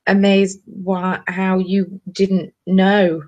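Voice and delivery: dragged out, searching for words; very slow. Real, not acted. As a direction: stretch each word as the brain stalls. Stalling, brain failing.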